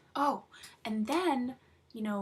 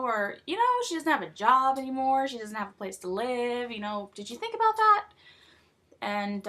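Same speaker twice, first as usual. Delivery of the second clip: normal talking voice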